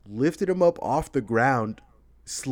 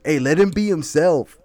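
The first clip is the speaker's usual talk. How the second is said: louder, mock street voice for the phrase; quoting the phrase; 5 semitones higher, 7 dB louder than usual. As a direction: toss off the phrase like a tough guy. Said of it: "the one that makes me and Ruben laugh".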